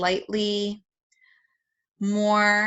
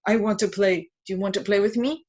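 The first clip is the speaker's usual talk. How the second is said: playful invitation. Shown as a demonstration. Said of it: "I might add a little bit like".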